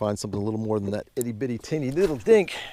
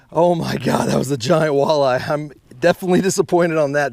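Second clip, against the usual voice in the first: louder, exasperated after losing the fish; loud dismay; 3 semitones higher, 8 dB louder than usual. Real, not acted. Angler telling viewers he lost the walleye, loudly dismayed. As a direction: let the disappointment burst out.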